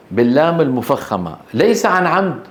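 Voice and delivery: usual speaking voice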